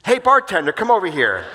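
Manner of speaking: loud, high call across the room. Retold or imitated. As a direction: shout for the bartender. Chairman calling out across bar.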